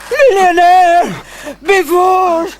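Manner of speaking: high, slow ecstatic cry; drawn-out ecstatic wail. Acted. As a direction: cry it out in rapture. Passion climax, crying out.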